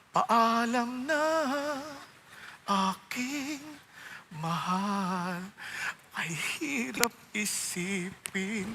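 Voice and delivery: slow, soft, fading goodbye to a lover; tender farewell. Retold or imitated. Man saying goodbye to his mistress, slow and soft. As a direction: let each word fall away slowly, aching.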